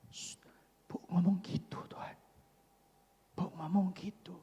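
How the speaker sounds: whispered aside, hushing him; hushed whisper. Acted. Peter pulling Jesus aside, whispering urgently. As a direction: whisper it so no one else hears.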